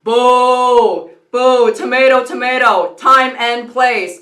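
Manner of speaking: loud booing jeer, last phrase slow; mock heckling. Extra in the movie heckling the kissing couple, loud mock jeer. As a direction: boo them loudly like a heckler.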